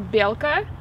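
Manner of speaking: "белка" said BYEL-ka, rising; Russian word. Shown as a demonstration. Teaching the word for squirrel, clear Russian.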